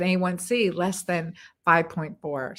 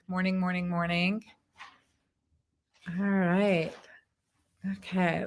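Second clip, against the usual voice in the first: usual speaking voice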